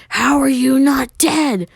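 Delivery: outraged, high slow demand; furious shriek. A narrator's reading. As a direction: scream it as she grabs him. Sakura grabs him furious.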